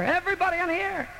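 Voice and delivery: high squeaky cheerful guest; squeaky visitor answering brightly; visitors' voice. Pretend. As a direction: sing it out high like a tiny fan.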